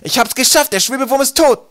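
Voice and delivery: loud, high-pitched jubilation; triumphant shout. A narrator's reading. Twig announces his victory over the worm, shouting jubilantly. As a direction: crow it out at full voice. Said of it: "brüllte Twig".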